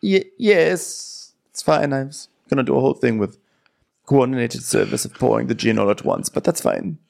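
high, halting put-on voice; mock-crestfallen waiter impression; deflated waiter. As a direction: stammer it out, pretending it's fine. Acting out deflated waiter's stammer.

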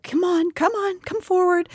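put-on high coaxing voice, like to a child; infantilizing coaxing. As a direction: coax her forward like a toddler. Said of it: "a little infantilizing".

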